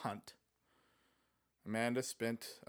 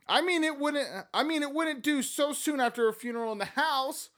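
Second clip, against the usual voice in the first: Amanda voice; 12 semitones higher, 4 dB louder than usual. Acted.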